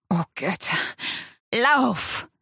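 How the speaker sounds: panting urgent plea to run; gasped out. A narrator's reading. Wounded man urging her to flee, gasped. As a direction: force it out between gasps. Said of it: "presste er keuchend hervor".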